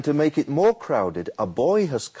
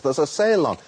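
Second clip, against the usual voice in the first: ordinary delivery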